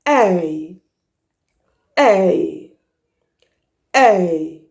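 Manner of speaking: "A" gliding from e to i; ay diphthong. Shown as a demonstration. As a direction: glide through the "A". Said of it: "also the diphthong".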